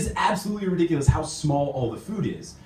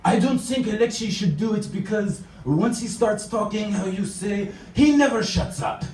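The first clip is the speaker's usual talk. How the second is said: higher, put-on French accent for the coworker; 8 semitones higher than usual. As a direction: mimic the French colleague's blunt English in a French accent. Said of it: "What he actually said was".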